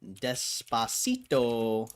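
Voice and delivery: slow, careful spelling-out read; sounding it out. Real, not acted. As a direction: read the name slowly, syllable by syllable. Sounding out the unknown name.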